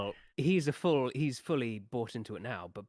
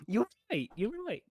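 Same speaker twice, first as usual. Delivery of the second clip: mocking caving in; 11 semitones higher than usual. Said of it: "And then she'll go".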